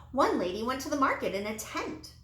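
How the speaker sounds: usual speaking voice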